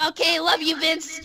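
usual speaking voice

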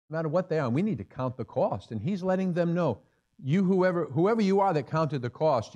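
fervent pulpit call; rising preaching fervour. Real, not acted. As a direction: preach it out to the crowd. Preacher building to exhortation.

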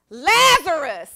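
big, high, drawn-out call; loud cry. Acted. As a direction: call him out loudly, stretching the name. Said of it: "he cried with a loud voice".

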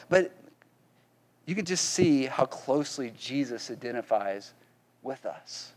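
ordinary talk

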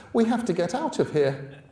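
put-on high, panicky girl's plea. Frightened girl begging Nick to leave, high and panicky. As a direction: rush it out in rising panic. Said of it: "in a higher-pitched, panicky voice".

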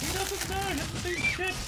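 lightly voiced squeaky aside; high put-on voice. Streamer whispering about an unaware player in a high voice. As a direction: squeak it out conspiratorially.

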